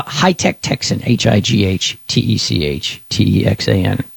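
ordinary talk

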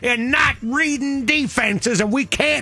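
put-on high, slow, furious rant from an old-lady radio caller; imitating Miss Lisa. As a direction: rant slowly and high like a fed-up fan phoning in. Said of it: "We need a Miss Lisa".

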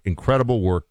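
ordinary talk, nothing marked

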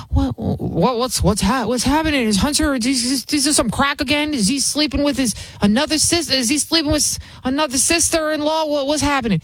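panicked Joe Biden. Retold or imitated. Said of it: "He was like".

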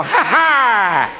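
ordinary talk

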